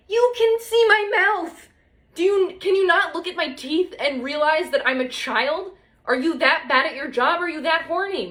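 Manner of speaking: high mock outrage, shaking your head; indignant. Real, not acted. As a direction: scold the orthodontist in disbelief. Indignant rant at the orthodontist.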